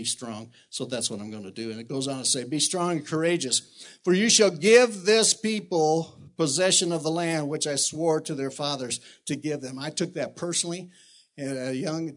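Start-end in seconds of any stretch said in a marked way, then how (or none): 2.4-9.8 scripture read aloud. Acted. Preacher reading the verse to the congregation, building louder. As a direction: proclaim the verse like a promise.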